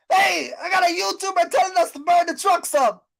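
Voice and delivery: hammed-up yell about burning trucks; mock outraged shout. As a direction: shout it like a scandalised viewer.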